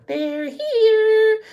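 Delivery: put-on very high, eerie child's voice; creepy little girl. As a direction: chirp it like a spooked little girl at the TV. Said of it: "Carol Ann's, like, sitting there in front of the T V going".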